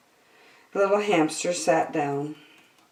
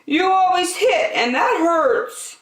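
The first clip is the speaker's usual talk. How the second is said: put-on high, childlike piggy voice; Piggy's voice; 13 semitones higher, 4 dB louder than usual. A narrator's reading. Piggy complains to little hamster, high and childlike. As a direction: squeak it out like a hurt little pig. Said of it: "said Piggy".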